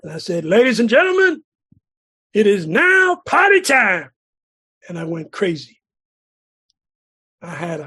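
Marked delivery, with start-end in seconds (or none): 0.4-4.1 loud, slowed shout like through a megaphone; bellowing through a bullhorn, high and loud; bullhorn announcement. Retold or imitated. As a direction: announce the party to the whole street. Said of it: "I pulled out that bullhorn".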